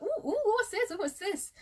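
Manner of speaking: high, squealing mum voice with a laugh; excited mum. Retold or imitated. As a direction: play her delighted mum cooing over the box. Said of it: "she's like".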